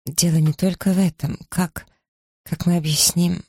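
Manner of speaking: put-on halting, high and young; faltering voice. A narrator's reading. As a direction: stumble over the words. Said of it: "её запинающийся голос".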